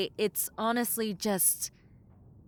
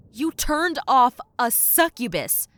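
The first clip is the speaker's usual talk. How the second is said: disbelief; 4 semitones higher, 8 dB louder than usual. Acted. Stunned by the client's request.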